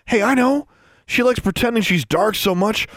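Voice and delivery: high-pitched scheming jeer; taunting. A narrator's reading. Bully suggests a torment to his friends, taunting.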